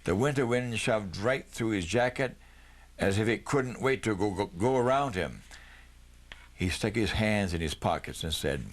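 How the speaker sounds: ordinary delivery, nothing marked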